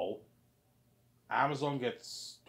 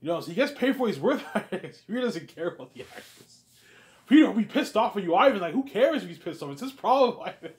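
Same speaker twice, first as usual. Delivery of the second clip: high, loud, laughing scoff; Peter dismissing it; 9 semitones higher, 11 dB louder than usual. Acted. Peter telling Ivan the actor's anger is not his problem, loud and laughing. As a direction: brush it off and laugh.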